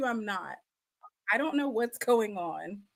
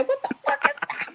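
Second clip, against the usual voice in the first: much higher, fast, laughing; retold shocked exclaim; faster than usual. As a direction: replay the jump-scare yelp. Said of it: "I said".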